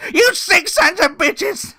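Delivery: slow high cartoon outburst; Peter Griffin yell. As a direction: bellow it like a cartoon dad. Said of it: "my Mick Foley is already not that good".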